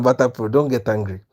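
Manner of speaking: usual speaking voice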